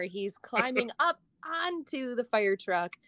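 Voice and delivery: ordinary talk, nothing marked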